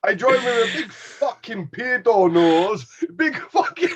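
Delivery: high, slow mock Scottish rant; hammed-up Scottish voice breaking into laughter; imitating Scottish accent. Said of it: "I do enjoy a good Scottish accent".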